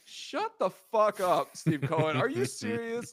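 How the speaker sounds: high, loud, slow exasperated yell; outraged. Real, not acted. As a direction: spit it out in disbelief. Reacting to Cohen quote.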